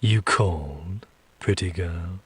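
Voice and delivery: low, slow, soft demon voice; seductive demon. Acted. Demon answering the woman's summons, low and slow. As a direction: draw it out low and softly, teasing. Unseen demon reveals himself to her.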